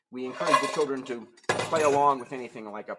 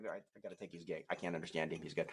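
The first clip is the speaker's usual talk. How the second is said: ordinary delivery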